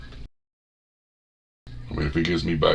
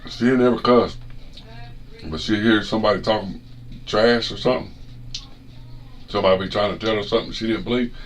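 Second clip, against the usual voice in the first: usual speaking voice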